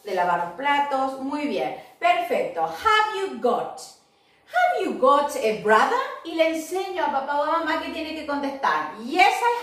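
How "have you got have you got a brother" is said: slow teacher model of the question. Shown as a demonstration. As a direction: have you got said slowly and clearly. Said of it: "teacher modelling question".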